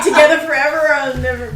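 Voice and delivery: ordinary talk, nothing marked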